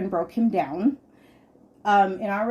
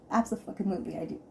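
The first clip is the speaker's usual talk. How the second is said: fast, softer Abso-fucking-lutely thrown away; quick aside; 5 dB quieter, much faster than usual. Real, not acted. Repeating to listeners that she annoys her husband, a fast softer throwaway. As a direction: rattle off Abso-fucking-lutely again under her breath.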